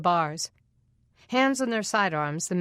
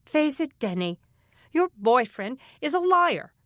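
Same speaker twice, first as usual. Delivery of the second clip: taunting; 6 semitones higher, 4 dB louder than usual. Acted. Boy taunting Denny.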